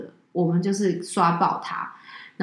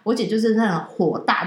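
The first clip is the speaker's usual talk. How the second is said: ordinary delivery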